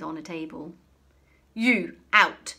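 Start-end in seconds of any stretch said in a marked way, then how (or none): 1.5-2.5 loud, curt dismissal; curt order. A narrator's reading. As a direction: snap it at someone beneath her. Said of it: "ordered the headmistress".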